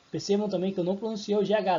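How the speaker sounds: normal talking voice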